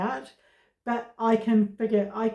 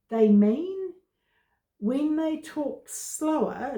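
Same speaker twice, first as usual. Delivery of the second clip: normal talking voice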